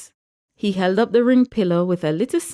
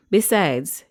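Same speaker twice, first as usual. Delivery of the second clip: ordinary delivery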